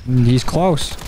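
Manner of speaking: ordinary delivery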